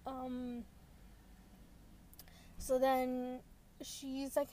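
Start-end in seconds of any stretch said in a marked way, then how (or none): none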